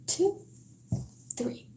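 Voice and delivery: usual speaking voice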